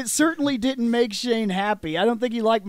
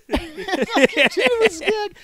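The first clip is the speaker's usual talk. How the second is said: laughing; 10 semitones higher, 4 dB louder, much slower than usual. Real, not acted. Laughing after voicing.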